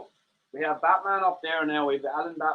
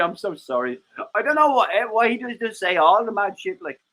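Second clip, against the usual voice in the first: normal talking voice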